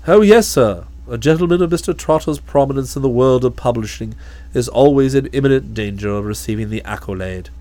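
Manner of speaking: low, calm, deferential butler voice; a low, imperturbable manservant; Jeeves the valet. Acted.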